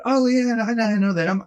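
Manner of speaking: a bright, surprised Oh yeah, lifted high; excited recognition. Retold or imitated. Zamboni driver recognizing the radio show, high and excited.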